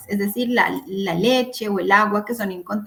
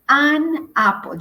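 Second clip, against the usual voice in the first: "an apple" said slowly, the "an" stressed and raised; careful model phrase; 4 semitones higher, 4 dB louder, slower than usual. Shown as a demonstration. Teacher modelling vocabulary for students, slow and stressed. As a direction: spell out "an apple" slowly, leaning on "an". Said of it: "utilizo an".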